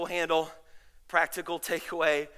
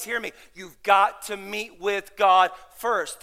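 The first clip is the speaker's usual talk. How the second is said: usual speaking voice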